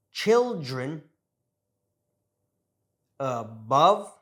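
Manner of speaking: careful word-by-word model from "About" to "lemon", schwas relaxed; words like "balloon" said singly, stressed syllable punched, schwa lazy; lazy schwa demo. Shown as a demonstration. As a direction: read "About, balloon, children" as a repeat-after-me list, unstressed vowels as "uh". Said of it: "really saying that schwa sound".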